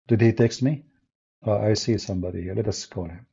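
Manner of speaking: ordinary delivery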